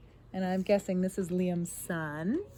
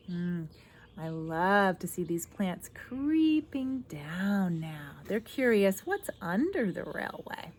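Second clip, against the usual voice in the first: usual speaking voice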